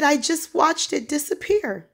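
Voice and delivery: high-pitched retold amazement; imitating a shaken, amazed man; astonished. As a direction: blurt it in stunned disbelief. Acting out his astonished report.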